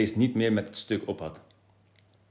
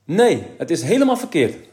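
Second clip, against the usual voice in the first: higher, louder protest; a boy insisting it's wrong; 7 semitones higher, 6 dB louder than usual. A narrator's reading. Kees protesting to his father, higher and louder. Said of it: "zei Kees".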